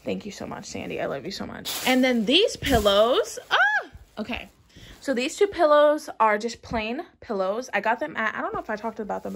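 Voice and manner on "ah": high happy shriek; excited squeal. Real, not acted. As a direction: let out a delighted squeal. Squeals at new pillows.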